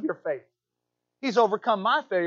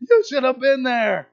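higher, louder, thrilled telling; excited disciples; 10 semitones higher, 5 dB louder than usual. Retold or imitated. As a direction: burst with joy telling the news.

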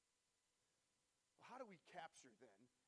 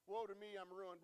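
louder, higher cry of woe; Isaiah crying out; 4 semitones higher, 10 dB louder than usual. Acted. Isaiah laying out his ruin before the Lord, crying out. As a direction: let it break out as a lament. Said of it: "as Isaiah cries out".

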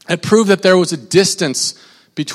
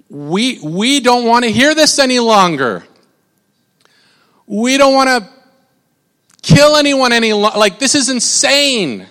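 terrified plea; 4 semitones higher than usual. Retold or imitated. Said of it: "they were terrified. In fact, they said".

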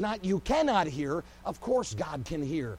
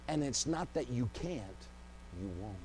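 preacher delivering the hard truth quietly; hushed punchline; 7 semitones lower, 8 dB quieter than usual. Acted. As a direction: drop to a near whisper on you won't. Sermon punchline dropped to a hush.

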